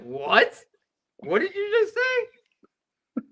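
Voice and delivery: very high, slow stunned question; shocked disbelief. Retold or imitated. Speaker retelling his shock at a stranger's threat, high, slow disbelief. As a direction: squeak it out in disbelief. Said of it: "I was like".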